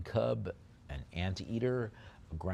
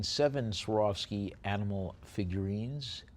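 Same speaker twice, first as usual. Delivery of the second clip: ordinary talk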